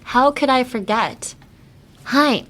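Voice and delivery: ordinary talk, nothing marked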